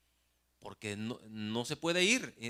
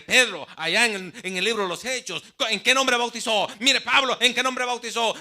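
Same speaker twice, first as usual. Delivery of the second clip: high, loud insistent lecturing; retold zealot arguing loudly; preachy shouting; 7 semitones higher, 13 dB louder than usual. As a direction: hammer the point home. Zealot insists on Jesus-name baptism.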